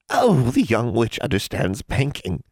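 slow, high, sly witch voice; amused hag. A narrator's reading. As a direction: savour it like a delighted crone.

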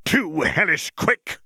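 high, slow gleeful taunt; delighted creature. Acted. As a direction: relish the chase. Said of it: "laughing in delight".

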